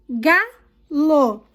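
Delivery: galo in two beats, GA strong; teacher sounding out "galo", first syllable strong; stressed syllable demo. Shown as a demonstration. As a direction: say "galo" split up, hitting "ga". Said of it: "pinte a sílaba tônica".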